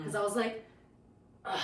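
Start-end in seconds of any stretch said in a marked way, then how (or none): 1.4-1.6 retold "ugh" of exasperation; exasperated groan. Teller reliving upset at a teacher, exasperated groan. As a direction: let out an acted, fed-up groan. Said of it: "I was getting more and more upset".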